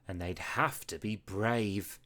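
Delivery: plain reading voice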